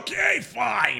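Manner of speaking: exasperated shout; mock exasperation. Real, not acted. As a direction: give in theatrically.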